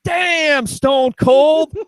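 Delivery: high, loud announcer yell; wrestling announcer. Pretend. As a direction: hype it like a ringside commentator. Wrestling announcer style exclamation.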